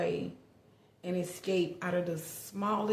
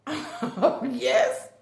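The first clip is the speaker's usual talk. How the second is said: embarrassed laughing yes; 4 semitones higher, 6 dB louder than usual. Retold or imitated. Embarrassed reply to operator.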